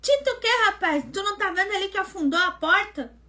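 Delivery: angry scolding. Retold or imitated. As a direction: point at the dent and let him have it. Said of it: "Eu braba, né?".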